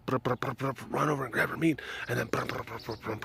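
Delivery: soft chirpy brup noises; imitating cat chirps. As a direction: mimic the cat's little trills. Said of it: "she would usually post up".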